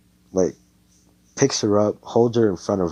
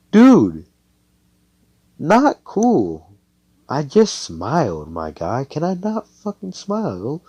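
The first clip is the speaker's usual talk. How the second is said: high, loud, drawn-out complaint; offended protest; 8 semitones higher, 8 dB louder, slower than usual. Acted. Asta slapped by Noelle for smiling, offended. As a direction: act wronged, voice shooting up.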